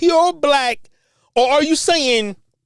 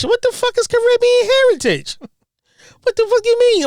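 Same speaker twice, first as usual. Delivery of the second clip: high-pitched indignant questioning; scornful disbelief; 10 semitones higher than usual. Real, not acted. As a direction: voice leaps up in outrage. Scoffing at the clip's claim.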